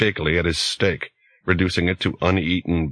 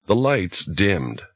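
ordinary delivery

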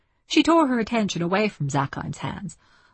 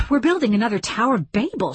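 quick, higher-pitched irritated retort; snapped; 5 semitones higher, 3 dB louder, faster than usual. A narrator's reading. Francine rebuffing Zakheim's advance, snapped. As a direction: snap it out fast in exasperation. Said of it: "she snapped".

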